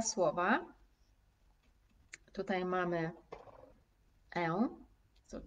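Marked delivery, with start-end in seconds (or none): none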